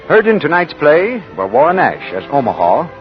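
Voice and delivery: ordinary talk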